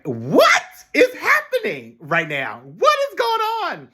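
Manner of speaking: slow, then a very high cry of what is going on; frantic disbelief. Retold or imitated. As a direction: at wits' end, hands up. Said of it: "I'm frustrated, I'm panicked".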